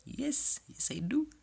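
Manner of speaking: high chipmunk reply, sped up fourfold; squeaky sped-up yes. Pretend. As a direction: answer like a fast-forwarded tape. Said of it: "T'as vu, je parle comme ça, vitesse fois quatre".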